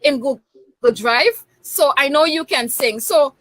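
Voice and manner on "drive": ordinary delivery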